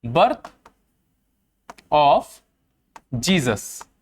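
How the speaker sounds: long pauses between each word; word-by-word dictation. Real, not acted. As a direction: dictate it one word at a time for note-takers. शब्द-शब्द लिखवाते हुए दोहराना. (एक-एक शब्द रुक-रुक कर लिखवाते हुए)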